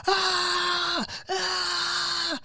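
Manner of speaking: high, drawn-out imitated scream; high mock wailing cry. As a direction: wail it like a screaming patient. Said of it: "he's still screaming".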